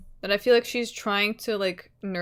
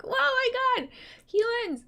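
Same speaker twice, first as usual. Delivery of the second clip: very high, hammed-up squeal of amazement; mock awestruck exclamation. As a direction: squeal as if amazed to see people. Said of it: "haven't made contact with people in a while".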